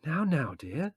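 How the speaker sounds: high, gentle motherly voice; calm them down tenderly; soothing. A narrator's reading. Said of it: "said Mother soothingly".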